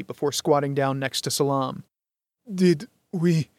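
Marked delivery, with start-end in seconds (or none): 2.5-3.5 higher, weak dying voice; barely able to ask; weak dying man. A narrator's reading. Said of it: "Salam said weakly".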